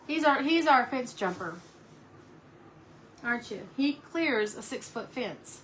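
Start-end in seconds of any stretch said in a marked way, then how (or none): none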